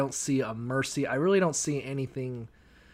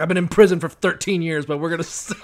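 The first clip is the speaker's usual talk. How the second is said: put-on loud, boastful drunk dad; a drunk dad's loud boast of prison; loud mock dad; 2 semitones higher, 7 dB louder, faster than usual. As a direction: brag it loudly like a deadbeat dad. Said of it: "He's like".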